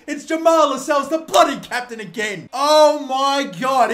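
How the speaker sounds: loud, high, slowing into awed disbelief; elated exclamation. Real, not acted. Fan hailing the captain's goal, elated shouting. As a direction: crow about the captain at full voice.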